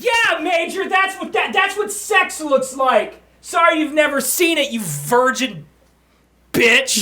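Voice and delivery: mocking taunt. Teasing the pet cat.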